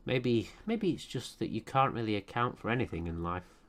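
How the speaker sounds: low, soft, measured reflection; musing monologue. Acted. Player pondering life's ruined plans, low musing monologue. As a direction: muse quietly, pausing between phrases. Muses philosophically over the game.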